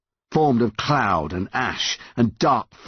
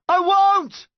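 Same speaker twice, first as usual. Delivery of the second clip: high, urgent yell; yelling back; 3 dB louder than usual. A narrator's reading. Son yelling back to his slipping mum. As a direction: yell a desperate promise. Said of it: "Connor yelled back".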